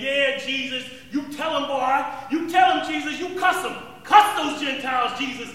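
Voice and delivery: loud egging-on shouts; rooting him on from the crowd; eager cheering. Retold or imitated. Cheering Jesus to curse Gentiles.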